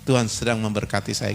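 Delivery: low, easy reassurance; calm humble answer. Shown as a demonstration. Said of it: "Gitu aja bahasanya".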